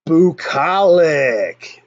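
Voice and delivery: "bucolic" said very slowly as "boo-KOL-ik"; word announced. Shown as a demonstration. "bucolic" presented to viewers as word of the day, slow. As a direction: drawl out "bucolic" syllable by syllable. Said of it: "that word is going to be".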